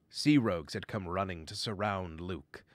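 plain reading voice

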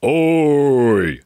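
loud, drawn-out giant growl. A narrator's reading. Giant spots intruders at his cave, loud growl. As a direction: bellow it like a giant. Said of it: "growled a giant".

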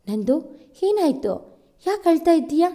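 alarmed. A narrator's reading. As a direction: ask in sudden worry why she is crying. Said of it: "ಗಾಬರಿಯಿಂದ ಕೇಳಿದನು".

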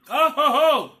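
ordinary talk, nothing marked

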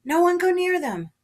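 loud, high-pitched urgent order; alarmed shout to a crowd; shouted warning. A narrator's reading. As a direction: yell to keep everyone back from danger. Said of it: "someone shouted".

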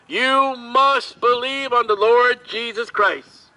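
usual speaking voice

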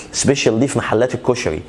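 normal talking voice

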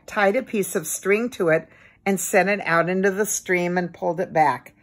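plain reading voice

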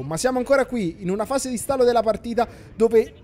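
lively play-by-play football commentary; match commentary. Acted. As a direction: call the match live. Live match commentary over video.

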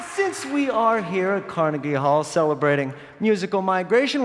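ordinary talk, nothing marked

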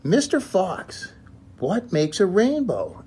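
high, slow little bunny voice; Little Rabbit asking. Acted. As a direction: draw the question out like a small curious rabbit. Little Rabbit asks Mister Fox.

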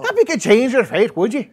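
high, loud Scottish movie-quote voice; Scottish accent. Acted. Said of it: "Should we just get it out?".

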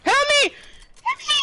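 screaming for help; desperate high shout; panicked scream. Real, not acted. Screaming for help while being killed.

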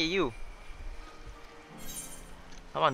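normal talking voice

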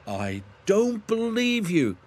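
high, drawn-out indignant denial; huffy little engine, high and slow. A narrator's reading. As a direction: huff it out, pitch soaring. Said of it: "huffed Thomas".

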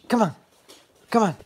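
ordinary talk

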